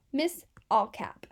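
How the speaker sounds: ordinary delivery, nothing marked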